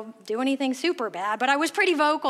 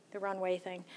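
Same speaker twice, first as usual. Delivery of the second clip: trailing "runway thing" soft and low; muttered, unsure; 6 semitones lower, 12 dB quieter than usual. Real, not acted. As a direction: mumble it, searching for the word. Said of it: "that's what we're calling it".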